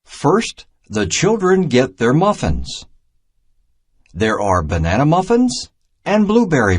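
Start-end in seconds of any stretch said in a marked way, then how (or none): none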